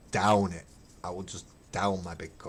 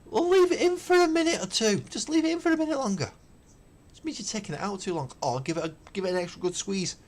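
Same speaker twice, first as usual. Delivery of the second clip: high, fast, mocking tone; a squeaky send-up of someone who can't brew; 12 semitones higher, 3 dB louder than usual. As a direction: mimic the clueless tea brewer. Mocking how others brew tea.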